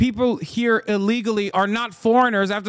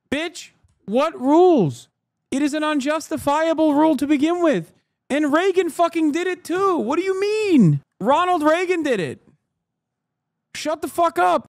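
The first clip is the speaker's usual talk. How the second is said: furious shout at chat; 8 semitones higher than usual. Real, not acted. Streamer yelling back at a chatter in fury. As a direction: blow up at the chat, voice climbing high.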